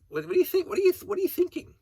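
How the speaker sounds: incredulous outburst at the neighbors; exasperated. Real, not acted. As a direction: shriek the question at them. Exasperated rhetorical question to neighbor.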